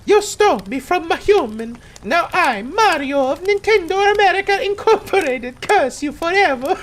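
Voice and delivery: very high squeaky bad Mario voice; Mario impression. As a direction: do a terrible cartoon Mario, cursing. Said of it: "the worst Mario impression".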